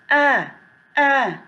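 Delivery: "a" as the first sound of apple; phonics A sound. Shown as a demonstration. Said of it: "we will pronounce". ("a", apple की पहली ध्वनि)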